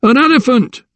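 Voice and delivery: high frantic cry; alarmed shout. A narrator's reading. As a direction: shout it as a warning. Hostler answering in fire chaos.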